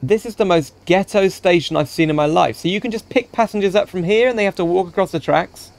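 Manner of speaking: ordinary delivery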